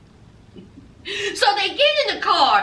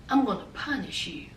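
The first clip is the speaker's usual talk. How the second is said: mock menacing voice; 9 semitones lower, 10 dB quieter than usual. Teasing threat to Yazan.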